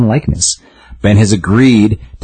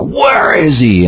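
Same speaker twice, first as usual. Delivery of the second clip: fired-up shout; 9 semitones higher, slower than usual. Retold or imitated. MJ storming into the hospital demanding Peter, shouting. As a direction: bellow it like she's storming in. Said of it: "all, like, fired up".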